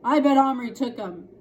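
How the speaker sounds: loud, sudden blurt; sudden accusation. A narrator's reading. Sister suddenly blames Omri, loud and sudden. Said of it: "said Adele suddenly".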